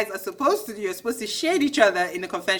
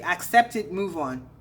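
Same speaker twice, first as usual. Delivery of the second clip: ordinary talk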